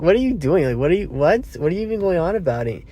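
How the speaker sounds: mock bewildered rapid protest; exasperated disbelief. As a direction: fire off confused questions. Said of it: "He's like".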